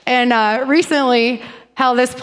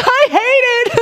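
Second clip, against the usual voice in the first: high, laughing blurt; 12 semitones higher, 4 dB louder than usual. Retold or imitated. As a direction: blurt it out while cracking up. Laughing confession to husband.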